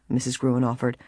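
usual speaking voice

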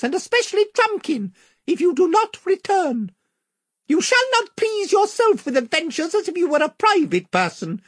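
very high, courtly little mouse voice; a tiny knight standing firm before his king; squeaky valiant mouse. A narrator's reading. Reepicheep the mouse speaking.

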